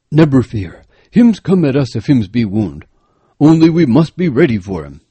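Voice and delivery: a guide stressing each warning word; cautious African guide. A narrator's reading. Guide voice, held.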